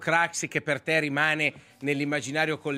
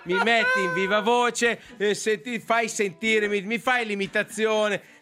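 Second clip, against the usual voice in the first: high, loud, slow and laughing; excited complaint; 8 semitones higher, 4 dB louder than usual. Real, not acted. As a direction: pile on the mock outrage. Heated teasing complaint.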